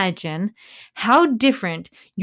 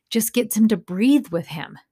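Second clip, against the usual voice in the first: ordinary delivery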